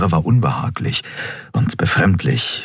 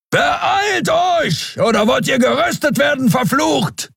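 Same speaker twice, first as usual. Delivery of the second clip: thundering shout; slower than usual. A narrator's reading. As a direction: roar it at them. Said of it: "donnerte Keru".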